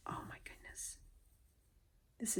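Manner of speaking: voiceless whisper of amazement. Real, not acted. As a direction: breathe it out in awe. Hushed awe at embroidery.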